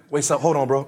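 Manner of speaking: low-pitched halting aside; mock alert hold-up; acted sudden interruption. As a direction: drop low and stop the chat short. Acts out stopping their own conversation.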